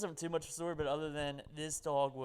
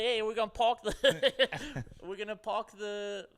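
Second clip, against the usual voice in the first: put-on slow, thick Boston accent, higher, breaking into laughs; 5 semitones higher, 4 dB louder, much slower than usual. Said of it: "the thickest Boston".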